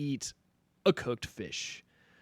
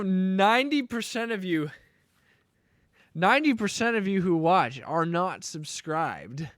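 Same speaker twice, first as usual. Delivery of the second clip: higher, louder ad-read push; hyped subscribe pitch; 8 semitones higher, 7 dB louder than usual. Acted. Streamer pitching chat to subscribe, hammed-up ad voice. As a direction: sell the subscription like a cheesy ad.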